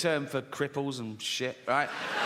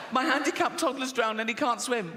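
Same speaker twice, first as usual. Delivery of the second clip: high-pitched frantic mother; acted desperate plea, pitched way up; mock panic; 7 semitones higher than usual. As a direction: cry for help in a high voice. Woman repeats her plea for help.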